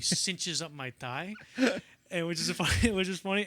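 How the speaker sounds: laughing through the words. Real, not acted. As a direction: giggle through it. Laughing while telling.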